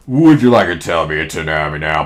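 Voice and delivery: low storybook character voice; Pooh's dialogue. Acted. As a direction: drop low for the bear's question. Pooh voiced in story reading.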